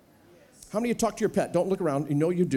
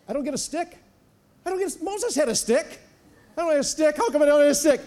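mock petulant Joshua, higher; whiny Joshua; 7 semitones higher than usual. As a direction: whine like a kid denied a toy. Joshua whining about stick.

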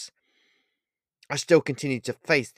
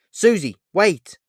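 loud, high, stretched call after her; shouted call; 9 semitones higher, 11 dB louder, slower than usual. Acted. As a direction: yell her name to stop her.

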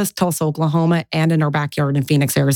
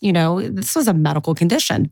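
normal talking voice

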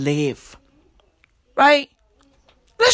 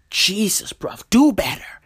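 normal talking voice

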